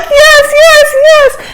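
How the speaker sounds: very high mock squeals; ecstatic agreement. Passengers asked to dive again, squealing with excitement. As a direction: squeal each one high and eager.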